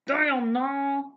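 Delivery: high, slow, drawn-out joke voice; sibling inside-joke voice, high and slow; silly family joke voice. Acted. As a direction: stretch it out slowly, teasing the sister. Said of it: "I think that's where this voice comes from".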